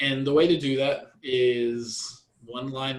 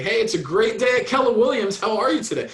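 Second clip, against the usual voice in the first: bright, higher, louder greeting with a smile; smiling phone greeting; 8 semitones higher, 7 dB louder than usual. Shown as a demonstration. Agent greets a caller with an audible smile. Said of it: "You can hear the smile in their voice".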